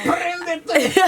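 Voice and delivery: voix bien plus aiguë et forte; taquiner, tout excité. Real, not acted. Réagit, taquin, au tatouage. (exclamation aiguë et forte)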